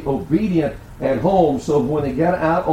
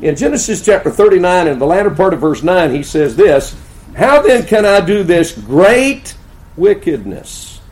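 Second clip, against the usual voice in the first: full-force preaching; 4 semitones higher, 10 dB louder than usual. Acted. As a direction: thunder the verse at the congregation. Climax of sermon, loud.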